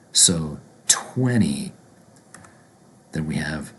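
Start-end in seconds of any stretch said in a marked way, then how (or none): 0.9-1.7 slow "twenty" said "twenny", falling. Shown as a demonstration. Teacher demonstrating twenty to learners, N sound for T. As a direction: let the second T in "twenty" melt into N. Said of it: "is gonna be pronounced like an N".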